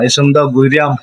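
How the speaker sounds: ordinary talk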